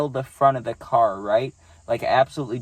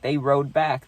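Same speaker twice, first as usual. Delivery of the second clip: ordinary delivery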